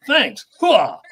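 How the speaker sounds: high, loud Army cheer; gung-ho soldier hooah. Retold or imitated. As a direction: bark it like a proud soldier. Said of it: "I was like".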